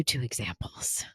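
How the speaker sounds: whispered aside to the viewers. Real, not acted. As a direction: lean in and whisper it.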